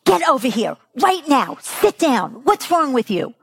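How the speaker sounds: scolding parent. Acted. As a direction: order a misbehaving child about crossly. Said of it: "this is what you hear".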